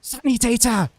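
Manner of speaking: high, hammed-up German cry; exclaimed in a put-on German accent; mock German shout. Shown as a demonstration. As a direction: call it out like a German battlefield cry. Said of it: "That's the only word I properly know".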